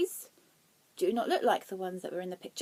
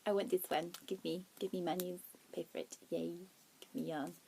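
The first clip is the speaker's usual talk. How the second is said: low, soft mumbled begging; hushed mock demand; 4 semitones lower, 7 dB quieter than usual. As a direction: beg quietly in a mock-greedy little voice. Retold pleading to boyfriend for money.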